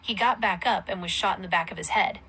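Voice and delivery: ordinary delivery, nothing marked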